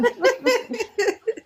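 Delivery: high giggling laugh; breathy high giggle. Real, not acted. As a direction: giggle at the joke. Laughs at the story.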